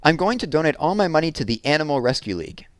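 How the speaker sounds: announcing a generous plan; Jessie's plan. Acted. Jessie telling Megan she will donate, proudly. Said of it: "Jessie said".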